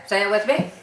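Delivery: ordinary talk, nothing marked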